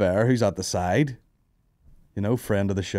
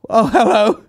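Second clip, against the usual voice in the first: loud, high-pitched comic hello; hammed-up high holler to a crowd; mock greeting; 13 semitones higher, 9 dB louder, slower than usual. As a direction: burst out with a startled stage greeting. Acting his entrance from behind curtain.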